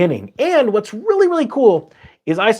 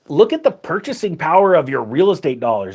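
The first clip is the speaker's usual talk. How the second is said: normal talking voice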